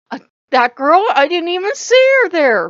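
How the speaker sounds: high, loud fake innocence; badly faked innocence; flustered denial. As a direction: protest loudly in a high voice, caught out. Acting out flustered denial to Odin.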